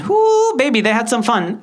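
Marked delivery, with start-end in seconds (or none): 0.0-0.5 loud, high "whoo"; high whoop. Real, not acted. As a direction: let out a high-pitched whoop. Gleeful exclamation about classmates' teasing.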